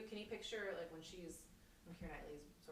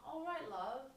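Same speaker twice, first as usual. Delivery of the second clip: put-on higher, British-accented kindly check-in; imitated British lady; 9 semitones higher, 5 dB louder than usual. British lady to weeping American on the platform, imitated accent. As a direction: imitate a concerned British stranger. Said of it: "these beautiful British people".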